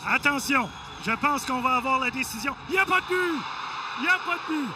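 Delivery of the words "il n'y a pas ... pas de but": excited announcement. Real, not acted. No-goal ruling announced.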